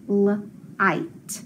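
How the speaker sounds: "l-ite" stretched slowly, sound by sound. Shown as a demonstration. Teacher sounding out the word for students, very slow. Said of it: "when I sound it out".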